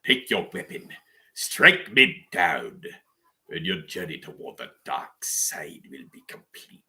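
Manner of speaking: slow, low evil whisper; tempting devilish whisper. Pretend. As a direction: lure them to the dark side. Palpatine cosplayer quoting Sidious.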